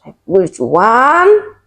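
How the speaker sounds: slow, rising strained count; acted effort of pushing; straining push. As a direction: bear down through the words, drawn out. Re-enacting a push in labor.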